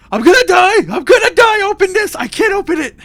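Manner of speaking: high, loud scream for his life; panicked screaming. Acted. Trapped captain screams over the PA, high panicked shouting. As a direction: scream it at the top of your voice, desperate to get out. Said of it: "He's just screaming".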